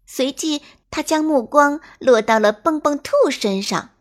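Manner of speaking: usual speaking voice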